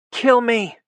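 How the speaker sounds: very high, loud scream; pinned woman screaming at her captor, high and loud; shouting. A narrator's reading. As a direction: shriek it with everything. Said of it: "she shouted".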